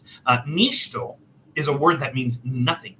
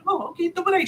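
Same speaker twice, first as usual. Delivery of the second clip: pitched way up, light and polite; high clerk voice; 3 dB quieter, faster than usual. Retold or imitated. Clerk answering the customer, in a high polite voice. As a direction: squeak it up like a friendly clerk. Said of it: "she was like".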